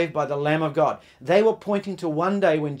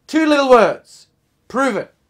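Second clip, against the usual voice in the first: fed-up wife retort; 8 semitones higher, 9 dB louder than usual. Retold or imitated. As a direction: fling the answer back at him. Fed-up wife answers from window.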